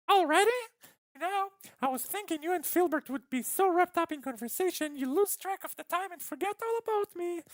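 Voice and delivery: extremely high, squeaky character voice; very high put-on voice, chattering on; Penny. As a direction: play her in a piercing falsetto.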